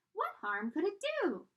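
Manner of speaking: high, loud tiny coaxing voice; high wheedling voice; urging pigtail. Acted. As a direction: coax him like a sly little pigtail.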